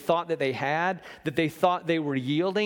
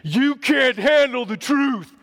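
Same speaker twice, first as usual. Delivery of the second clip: slow, loud movie-villain roar; famous film quote, hammed-up; Jack Nicholson line; 10 semitones higher, 9 dB louder, slower than usual. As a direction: bellow it like the colonel on the stand. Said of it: "And Jack Nicholson, this is famous".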